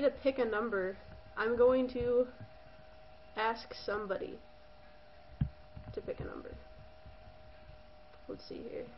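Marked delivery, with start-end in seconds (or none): 5.9-8.8 whispering. Real, not acted. Muttering to herself while typing.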